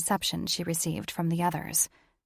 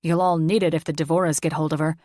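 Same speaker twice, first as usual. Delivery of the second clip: fast, louder scowling warning; 6 dB louder, faster than usual. A narrator's reading. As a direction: bite it out at his brothers. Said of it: "Drasko scowled at his brothers".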